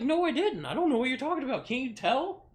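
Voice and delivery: put-on scared teen pleading innocence to the dad; panicked denial. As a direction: blurt the denial in a rush.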